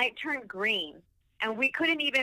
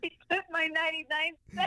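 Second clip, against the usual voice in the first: higher whiny plea; whiny drunk complaint; 7 semitones higher, faster than usual. Retold or imitated. Recalling her drunk complaint, higher whine. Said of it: "And I'm like".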